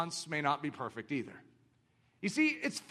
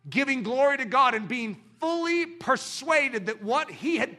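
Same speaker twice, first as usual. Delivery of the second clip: preaching; 4 semitones higher, 5 dB louder than usual. Acted. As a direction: build the verse with full conviction. Preaching scripture.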